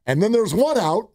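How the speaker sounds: normal talking voice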